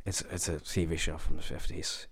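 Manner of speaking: soft retold aside whisper. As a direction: lean in and whisper it. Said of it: "he kinda whispers to them".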